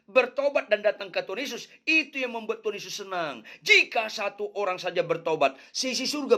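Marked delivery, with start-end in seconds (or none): none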